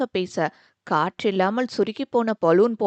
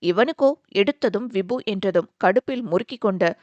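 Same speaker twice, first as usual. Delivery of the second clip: usual speaking voice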